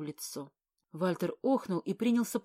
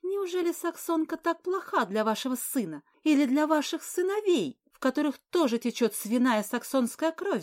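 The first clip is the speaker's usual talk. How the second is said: much higher, loud indignant outburst; agitated; 5 semitones higher, 5 dB louder than usual. Acted. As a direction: unable to hold back, high and loud. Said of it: "слишком возбуждена, чтобы сдержаться".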